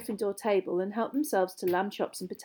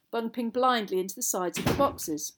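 usual speaking voice

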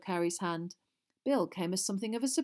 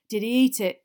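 a touch of eagerness, louder; excited; 3 semitones higher, 10 dB louder than usual. A narrator's reading. Fred eagerly asks Harry about the prank, excited. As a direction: ask it with loud excitement. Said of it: "said Fred excitedly".

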